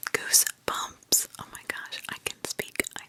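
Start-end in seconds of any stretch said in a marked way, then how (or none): 0.1-1.3 "goosebumps" stretched very slow and higher; slow drawn-out. Shown as a demonstration. Reacting to the creepy story, slow drawn-out whisper. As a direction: savor "goosebumps" slowly, pitched up.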